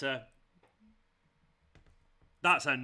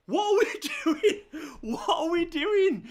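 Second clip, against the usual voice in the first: much higher, louder, slow, half laughing half crying; exasperated laughing shriek; 6 dB louder, slower than usual. Real, not acted. Fan screaming at Cancelo's mistake, laughing in despair. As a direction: cackle in despair at the blunder.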